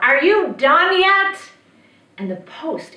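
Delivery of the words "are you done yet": loud, high, drawn-out yell; slow impatient witch's holler. A narrator's reading. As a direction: yell impatiently to someone outside. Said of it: "she would start yelling out".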